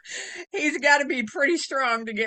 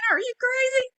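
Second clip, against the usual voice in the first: mock indignant; 13 semitones higher, 4 dB louder than usual. Mock indignant reply to teasing.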